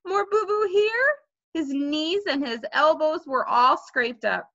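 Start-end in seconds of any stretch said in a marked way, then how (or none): 0.0-1.2 put-on high small-child voice, each phrase rising; whimpering little boy. A narrator's reading. Fudge shows brother his wounds, whimpering. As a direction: point out each hurt like a toddler. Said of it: "showing me all his wounds".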